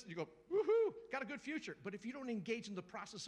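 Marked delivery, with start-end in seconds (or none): 0.5-1.8 a high, loud celebratory woohoo; hammed-up celebrating believer; mock excited whoop. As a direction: cheer like someone just got good news. Excited hearer of prophecy.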